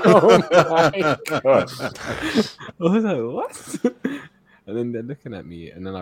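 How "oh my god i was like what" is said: high, drawn-out, laughing shock; laughing disbelief. Retold or imitated. Speaker retells reaction to woman's accusation, high laughing disbelief. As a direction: stretch it out high through laughter.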